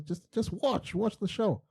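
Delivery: high, pleading "just watch"; mock exasperated. Brother begging sister to just watch, high exasperated. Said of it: "I'm like".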